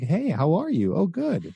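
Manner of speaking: high, each line lifting at the end; nervous upturned endings; rising tonality. Shown as a demonstration. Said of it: "it's called breaking rapport tonality".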